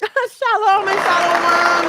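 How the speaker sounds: slow, loud laughing send-off. Real, not acted. Breaking into laughter at her own joke, loud and slow. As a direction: laugh it out slowly.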